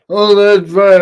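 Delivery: ordinary talk, nothing marked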